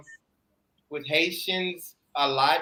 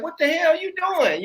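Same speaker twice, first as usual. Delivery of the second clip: retold indignation, pitched higher; mock-outraged challenge, pitch raised; indignant outburst; 10 semitones higher than usual. Shopper to people looking over her head at the card machine, indignant. Acting out reaction to line crowders.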